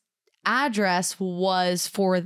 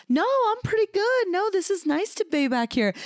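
high, breezy imitation of his calm voice; mock calm fiancé; 12 semitones higher than usual. Teasing her fiancé's calm reply, lightly high-pitched. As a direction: mimic him breezily brushing it off. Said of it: "And you were like".

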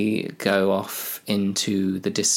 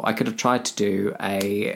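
ordinary talk, nothing marked

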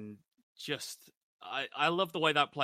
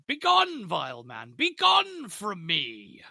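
high, loud, slow dramatic shout; theatrical furious banishing; 7 semitones higher, 10 dB louder, much slower than usual. Retold or imitated. Dennis throws the buyer out, a high, loud, slow furious shout. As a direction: roar him out of the room like a wronged king.

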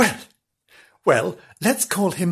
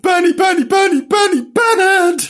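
high, loud calling out across a garden; calling the cat; 8 dB louder than usual. Pretend. Imogen calling the cat in the garden, loud high calls. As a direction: call the cat home at full voice. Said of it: "stand in the garden and call him".

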